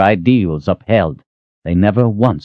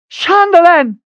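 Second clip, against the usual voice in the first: calling out; 5 dB louder, slower than usual. Acted. Calling out in the dark.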